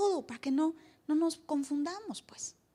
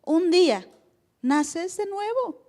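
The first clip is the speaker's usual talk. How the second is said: slow, loud punch on "naces de nuevo"; emphatic slow; 4 semitones higher, 5 dB louder, slower than usual. Real, not acted. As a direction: land "Un día naces de nuevo" slow and weighty. Pastor stresses key phrase. (énfasis lento y fuerte)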